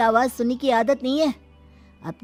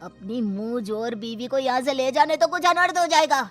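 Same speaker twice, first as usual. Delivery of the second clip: rising, threatening uncle voice; irate elder, pitch climbing; angry patriarch; 4 semitones higher than usual. Acted. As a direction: warn him with building anger. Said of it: "Mahendra गुस्से से सागर को आवाज देता है".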